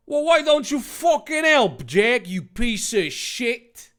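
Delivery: high, loud character outburst; angry retort. Acted. Dave demanding help from Jack, angry. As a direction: fire back loudly, then slow on the insult. Dave's acted quarrel with Jack.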